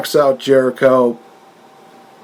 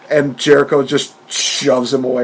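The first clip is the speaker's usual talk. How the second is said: usual speaking voice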